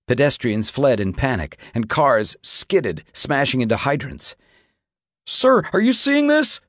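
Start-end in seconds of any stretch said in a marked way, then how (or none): 5.3-6.6 soldier calling. A narrator's reading. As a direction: call out to the general in alarm. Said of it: "the soldier called".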